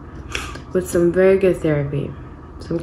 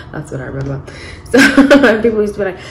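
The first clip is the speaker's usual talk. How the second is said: normal talking voice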